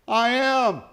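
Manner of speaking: high, ringing proclamation; Jesus declaring. Acted. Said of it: "Jesus said".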